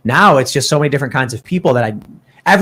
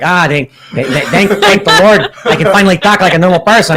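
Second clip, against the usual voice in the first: put-on Chicago accent; 4 semitones higher, 3 dB louder than usual. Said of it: "do your Chicago accents".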